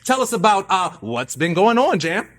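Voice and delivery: playing a talk show host introducing himself; announcer voice. Mock host voice. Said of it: "that's exhausting".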